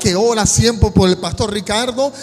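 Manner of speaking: predicando a gritos, con fervor; predicación con fuerza. Acted. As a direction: clamando ante la congregación. Preaches the story at full force. (predicador que clama con fervor ante la iglesia)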